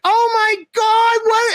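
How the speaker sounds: high, slow, shouted fake shock; faked surprise. Walking into his surprise party, faking a big shocked shout. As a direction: overact being caught off guard. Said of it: "I have to pretend".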